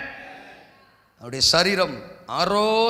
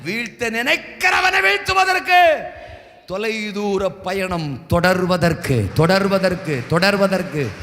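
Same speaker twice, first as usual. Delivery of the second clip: loud, slow building sermon cry; rising revival shout; preaching climax; 4 dB louder, slower than usual. Acted. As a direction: build the crowd up, stretching each word.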